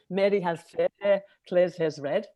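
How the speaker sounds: quick, broad Scouse rendering of the drill; Liverpool accent. Shown as a demonstration. Showing the untrained way, Liverpool accent. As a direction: rattle it off in everyday Scouse. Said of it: "in a Liverpool accent, you'd say".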